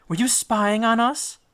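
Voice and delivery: put-on high, suspicious girl's challenge; accusing young woman. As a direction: demand an answer, voice up high. Girl accuses Odysseus.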